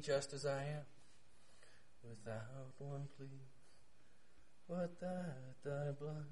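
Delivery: very soft, low, reverent lines; slow, intimate murmur; hushed hymn. Acted. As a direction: let the hymn out barely audibly. Quotes a hymn very softly.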